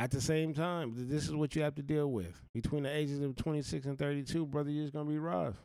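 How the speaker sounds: normal talking voice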